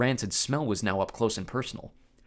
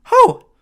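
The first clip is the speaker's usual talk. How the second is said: very high, loud panicked yelp; 18 dB louder than usual. Acted. Grover caught out, a high yelp. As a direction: squeal in panic. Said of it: "Grover yelped".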